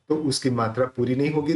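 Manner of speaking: normal talking voice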